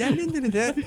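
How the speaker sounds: high sing-song kitty chant; cutesy nya speak. Pretend. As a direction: chirp it like a cartoon cat. Mocking the Nyan Cat meme.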